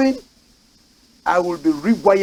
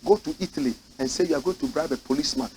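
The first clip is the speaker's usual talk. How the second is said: ordinary talk, nothing marked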